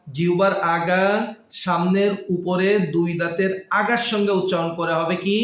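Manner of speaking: ordinary talk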